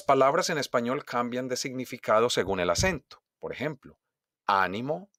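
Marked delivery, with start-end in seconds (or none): 4.5-5.1 "ánimo" stressed on the opening A; stress on first syllable. Shown as a demonstration. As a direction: hit the A that begins "ánimo". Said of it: "tiene acento en la primera A". ("ánimo" con golpe de voz en la primera A)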